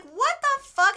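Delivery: high, loud shocked what the fuck; shocked outburst. Retold or imitated. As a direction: blurt it out in disbelief. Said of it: "and I'm like".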